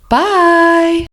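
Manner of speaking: bright, high farewell to the viewers; bubbly sign-off; cheery goodbye. Real, not acted. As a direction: sing out the goodbye.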